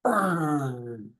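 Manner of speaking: deep meditative mm; low hum. Retold or imitated. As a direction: imitate the teacher's grounding hum. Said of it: "he'd make a sound like".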